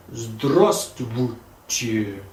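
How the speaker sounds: "Здравствуйте" very slow and low, syllable by syllable; careful Russian pronunciation. Shown as a demonstration. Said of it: "teach me how to properly say".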